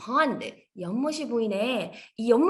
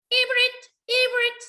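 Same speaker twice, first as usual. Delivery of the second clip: cheeky croak; 10 semitones higher, 3 dB louder, much slower than usual. Acted. Greenie croaks backwards again.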